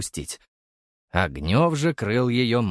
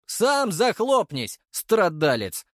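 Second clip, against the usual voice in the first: higher, slow retort shouted back; shouting back; 9 semitones higher, slower than usual. Acted. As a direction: yell back in annoyance. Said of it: "крикнул я в ответ".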